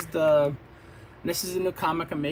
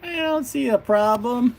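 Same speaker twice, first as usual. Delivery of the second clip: high, breezy and unbothered; Adam, pitched up and a bit louder, unconcerned; Adam's carefree voice; 9 semitones higher, 5 dB louder than usual. Acted. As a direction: shrug off the chaos. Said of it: "then he goes".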